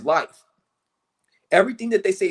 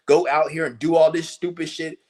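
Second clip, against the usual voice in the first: ordinary talk, nothing marked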